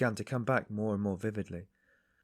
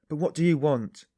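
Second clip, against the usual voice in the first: loud, high, angry shout; 6 semitones higher, 9 dB louder than usual. A narrator's reading. Porter shouting at the loitering stranger, angry and loud. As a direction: shout it, losing patience. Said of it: "the porter shouted again".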